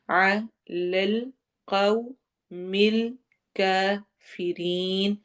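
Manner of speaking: علی القوم الکافرین chanted very slowly, the final ی stretched; tajweed recitation. Shown as a demonstration. Teacher chants the ending slowly for students. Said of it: "یا مدہ ہوگی یہاں پر اینڈ پر".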